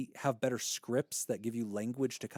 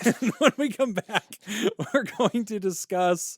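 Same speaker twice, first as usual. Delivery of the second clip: podcast break announcement; 7 semitones higher, 9 dB louder than usual. Real, not acted. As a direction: send it to break big and loud, laughing between phrases. Host announces break and show name.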